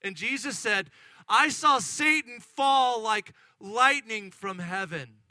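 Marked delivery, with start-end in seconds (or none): 1.3-5.2 loud, slow proclamation; forceful pronouncement. Acted. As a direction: proclaim it firmly, word by word. Jesus' answer repeated over the excitement.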